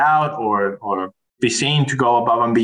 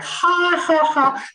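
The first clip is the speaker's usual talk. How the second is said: high mock guffaw carrying into scornful disbelief; mocking belly laugh; 7 semitones higher than usual. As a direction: imitate a derisive belly laugh, pitched high. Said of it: "belly laugh".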